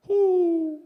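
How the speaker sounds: high, loud single owl call; one clear hoot across the water; distant owl hoot. Retold or imitated. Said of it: "all of us hear".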